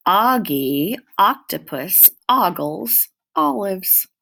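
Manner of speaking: each word slow, leaning on the first sound; stressed opening sounds. Shown as a demonstration. Teacher to children, hitting the first ah sound. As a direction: stretch the O at the start of every word. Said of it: "listen to this sentence and see if you can guess".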